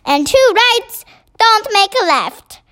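high, slow giant's interjection; excited cry. A narrator's reading. BFG caps the Queen's saying with a high cry. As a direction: chime in high, word by word. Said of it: "cried the B F G".

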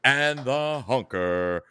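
slow booming ring announcer intro. Acted. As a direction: announce him like a wrestling entrance.

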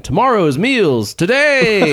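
slow, high tagline delivery; slogan voice. Shown as a demonstration. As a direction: announce it like an ad slogan. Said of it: "that should be the slogan".

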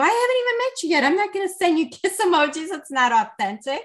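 high-pitched, rapid mock protest, settling at the end; retold indignant protest. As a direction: rush out a high, indignant refusal as if quoting yourself. Retold refusal to send kiss emojis.

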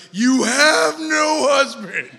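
mock condemning shout at the woman; harsh accusing shout. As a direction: blast it like an exposing accusation. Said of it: "and be like".

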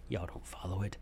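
soft unvoiced whisper, dropped way down; whispered aside. Real, not acted. Host whispers a teasing aside to podcast listeners.

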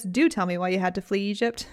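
normal talking voice

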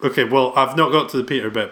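ordinary talk

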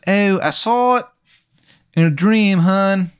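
high-pitched fake Baltimore accent; fake Maryland accent. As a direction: put on a bad Maryland "hun" voice. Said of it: "he's faking being from Maryland".

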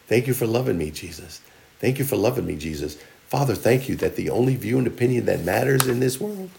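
soft, low, tender prayer; heartfelt prayer. Acted. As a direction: pray it quietly and gratefully. Models a prayer to Jesus.

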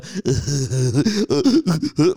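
exaggerated stuttering sobs; hammed-up blubbering noises; fake crying noises. Shown as a demonstration. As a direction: imitate ugly crying without words. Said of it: "cry and make the".

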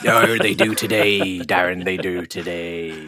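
put-on slow, light Welsh accent; mock Welsh accent. Co-host answers his brother in a mock Welsh accent. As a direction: insist in a gentle Welsh accent. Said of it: "pirates don't have Welsh accents".

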